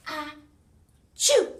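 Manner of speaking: loud, high mock sneeze; hammed-up achoo; big sneeze. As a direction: act out a huge cow sneeze. Cow sneezes in story.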